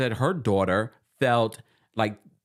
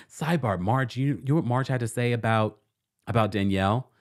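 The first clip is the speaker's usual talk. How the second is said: normal talking voice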